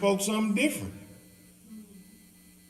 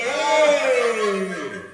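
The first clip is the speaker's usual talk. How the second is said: excited call; 6 semitones higher, 10 dB louder than usual. Retold or imitated. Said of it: "they was like".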